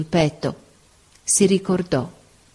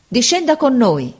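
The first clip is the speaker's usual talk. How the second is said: shouting up; 7 semitones higher than usual. A narrator's reading. Sailors below beg the captain to come, shouting. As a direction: urge him down with a raised voice. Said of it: "gridarono di sotto".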